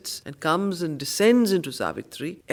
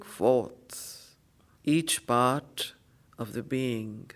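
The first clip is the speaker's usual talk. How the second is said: poem recitation, fading; 2 semitones lower, slower than usual. Acted. Teacher reciting Savitri to students, fading to a hush. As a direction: start bold, then sink to a reverent hush.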